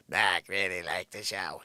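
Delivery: low put-on voice of the assistant; Joanne. Imitating the assistant's phone call, low Joanne impression. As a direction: drop low into a caricature of her. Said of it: "This is exactly how she sounds".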